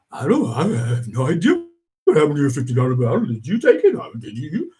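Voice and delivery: stammering, evasive roommate act; rushed feigned innocence; acted denial. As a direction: play a flustered culprit dodging blame. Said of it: "And he goes".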